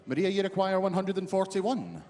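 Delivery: measured announcer call; darts score caller. Real, not acted. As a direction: announce the checkout steadily.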